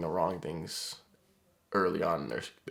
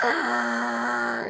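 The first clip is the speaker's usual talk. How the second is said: high raging roar; angry roar; 7 dB louder than usual. Retold or imitated. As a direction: let out a wild frustrated roar. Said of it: "He's like".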